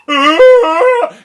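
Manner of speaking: sudden gasp, then high loud shriek; gasp and scream. Acted. Jack seeing the princess in the window, shrieking. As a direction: gasp and let out a big shriek.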